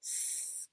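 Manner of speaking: soft, long hissed S; only the S noise, no vowel; final sound alone. Shown as a demonstration. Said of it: "What's that final sound?".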